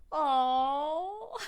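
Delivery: ordinary delivery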